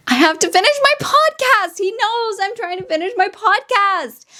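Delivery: high-pitched frustrated insistence; high exasperated complaint; exasperated plea. Real, not acted. Pleading exasperated with partner.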